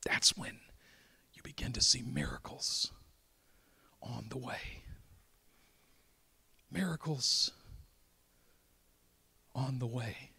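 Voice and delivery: breathy near-whisper after the shout; hushed preaching. Acted. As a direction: pull them in with a hush. Preacher drops to a hush.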